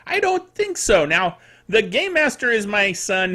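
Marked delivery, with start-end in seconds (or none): 0.1-1.1 higher, scoffing dismissal; flat refusal. Real, not acted. Vlogger scoffs at a Barbie that spies on kids, high dismissive refusal. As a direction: wave the idea away with a high scoff.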